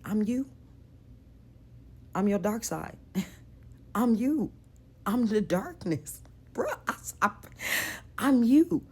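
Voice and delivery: high, strange voice of the dark double; eerie voice of your dark side; dark figure voice. Retold or imitated. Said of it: "The figure, the dark figure, said".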